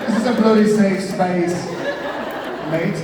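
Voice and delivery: loud, high fake British accent; jokey indignant British accent; mock British accent. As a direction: protest in a hammed-up British accent. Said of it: "do a nice London accent".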